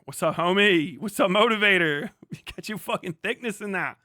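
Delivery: usual speaking voice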